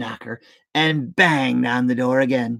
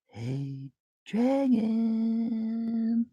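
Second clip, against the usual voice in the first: much softer, hushed call; a princess softly whispering to a dragon; 5 semitones higher, 9 dB quieter, much slower than usual. A narrator's reading. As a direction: whisper it gently so as not to wake him. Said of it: "Elizabeth whispered very softly".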